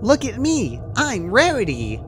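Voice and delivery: very high, gleeful show-off voice; Pinky clowning. A narrator's reading. Pinky's macabre comedy act, squealing high and gleeful. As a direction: ham it up like a comic act. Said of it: "Pinky laughed".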